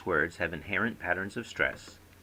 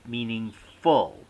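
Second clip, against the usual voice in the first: "Meaningful" slow and louder, heavy stress on FUL; emphatic correction; 6 dB louder, much slower than usual. Acted. Speaker correcting a confused friend, emphatic meaning-FUL. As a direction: stretch "Meaningful" out, hitting FUL hard.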